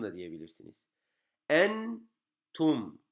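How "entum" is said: "Entum" raised and held on n, closed on m; "Entum" as a slow two-part reading demo; pausing on nun and mim. Shown as a demonstration. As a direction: rest on n, then on m in "Entum". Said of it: "hem ende duruyoruz, hem nunda duruyoruz".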